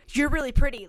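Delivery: nervous customer blurting a compliment, loud and quick; blurted shout. Retold or imitated. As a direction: blurt it out fast like a nervous guy. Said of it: "just shouts out".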